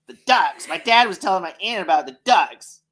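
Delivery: a touch of indignant friend; mock upset. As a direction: complain about the ducks in mild mock anger. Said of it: "she's like".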